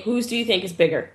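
normal talking voice

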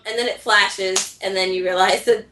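normal talking voice

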